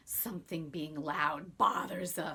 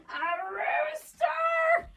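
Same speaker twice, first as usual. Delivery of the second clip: much higher, slow, astonished cry of disbelief; shocked question; 12 dB louder, much slower than usual. Acted. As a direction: squeal it in disbelief, stretched out. Old man reacts to odd advice.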